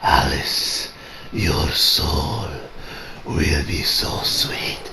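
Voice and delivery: low, slow, breathless whisper; creepy villain whisper. Retold or imitated. Villain whispers to Alice about her soul, creepy whisper. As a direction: hiss it like a predator savoring prey. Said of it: "was like".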